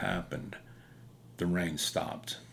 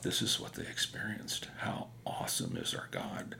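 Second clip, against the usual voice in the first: awed whisper; 10 semitones lower, 5 dB quieter than usual. Retold or imitated. Awed thought at parted rain.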